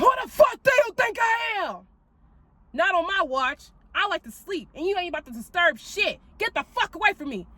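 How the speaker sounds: high, far-off shout at the demon; warrior yelling at a demon. Retold or imitated. Confronting the demon in her bedroom, fierce shout. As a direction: tell the spirit off like a warrior. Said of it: "the warrior jumped out".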